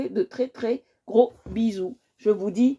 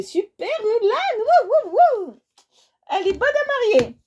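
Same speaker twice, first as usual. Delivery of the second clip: much higher and louder hyper whoops; overexcited; 12 semitones higher, 4 dB louder than usual. Real, not acted. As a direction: whoop like too much caffeine. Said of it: "Non, je suis pas folle. Je suis juste, euh, surexcitée".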